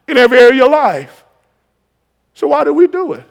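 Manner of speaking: loud, high preacher's shout; rising to a roar over the congregation; full-force preaching. Acted. Preacher to congregation, calling angels in a full-force shout.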